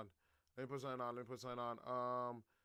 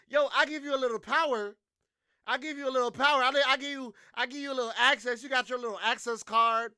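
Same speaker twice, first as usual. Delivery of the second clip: louder, higher hammed-up ranting; mock Trump rant; 4 semitones higher, 6 dB louder than usual. Trump scolding Elon over Doge, loud mock rant. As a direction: bark at him like a furious boss. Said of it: "Trump is like".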